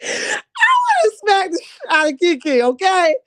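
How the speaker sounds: tearful laughing exclaim. Real, not acted. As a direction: blurt it through laughter. Laughing outburst.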